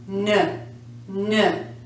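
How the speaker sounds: low voiced "nn" for "n"; isolated N sound. Shown as a demonstration. Teacher showing the last sound vibrates, voiced "n". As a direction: hum "n" briefly, not the letter name. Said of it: "has a vibration".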